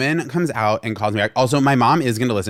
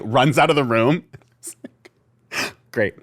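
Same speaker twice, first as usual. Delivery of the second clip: normal talking voice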